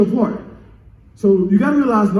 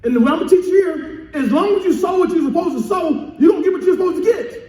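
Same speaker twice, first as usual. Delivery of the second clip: higher, driving preacher's delivery; impassioned preaching; 5 semitones higher than usual. Real, not acted. Pastor encouraging the congregation about harvest, impassioned preaching. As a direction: press the lesson home with rising pitch.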